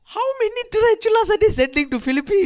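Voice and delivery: high, loud disbelief; shocked, loud question; incredulous. Real, not acted.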